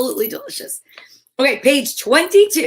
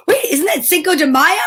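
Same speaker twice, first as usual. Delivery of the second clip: surprised; 6 semitones higher, 5 dB louder than usual. Real, not acted. Sudden realization.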